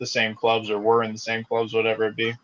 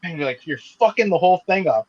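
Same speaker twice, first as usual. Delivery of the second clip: higher, exasperated retold complaint; 6 semitones higher, 3 dB louder than usual. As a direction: groan at a teammate wrecking your plan. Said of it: "I'm like".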